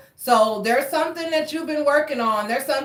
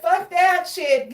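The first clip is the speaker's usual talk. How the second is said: defiant; 8 semitones higher, slower than usual. Retold or imitated. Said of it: "He said".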